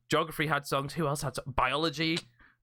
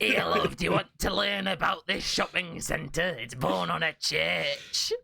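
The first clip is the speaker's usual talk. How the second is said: a lightly voiced doddering old man; weird old man voice. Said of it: "I talked like a weird old man".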